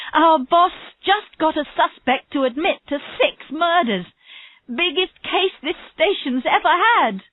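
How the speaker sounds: high, thrilled telling of big news; excited boasting. Acted. Havers tells another officer about the boss's case, excited. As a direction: gush proudly, pitched right up.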